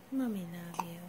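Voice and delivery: hushed tender whisper. Real, not acted. Mother settling baby to sleep.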